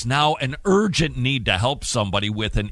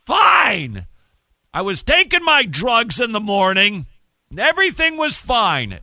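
loud; 9 semitones higher, 6 dB louder than usual. Real, not acted. Exaggerating how fine he was.

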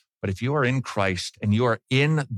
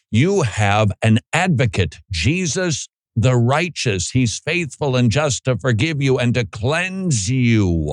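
louder, driving pulpit voice; preaching at full force; 6 dB louder than usual. Real, not acted. Preacher warning the congregation against sin, forceful. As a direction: preach it hard and emphatic.

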